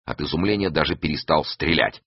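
usual speaking voice

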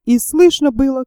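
usual speaking voice

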